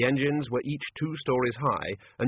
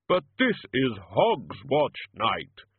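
Death voice; much slower than usual. Acted.

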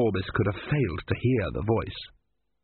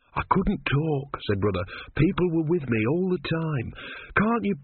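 higher, pleading questions rising in distress; anxious young monk; 6 semitones higher than usual. A narrator's reading. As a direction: beg the god to understand, slowly and anxiously. Brother pleads anxiously with the god.

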